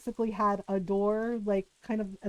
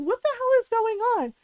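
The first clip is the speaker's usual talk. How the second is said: shocked disbelief; 10 semitones higher, 5 dB louder than usual. Retold or imitated. Kids rushing to see commotion.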